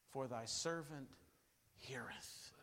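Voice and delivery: low, soft, breathed out; whispered. Retold or imitated. Eli teaching young Samuel the reply, whispered. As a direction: fade into a whisper. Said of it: "you say".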